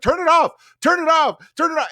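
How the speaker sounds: very high, loud repeated pleas; high loud mock panic chant; chorus of panic. Others freaking out.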